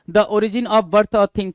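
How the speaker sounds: reading the definition out slowly; slow dictation. Shown as a demonstration. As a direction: read it slowly so students can note it down. (धीमी आवाज़ में परिभाषा पढ़ना)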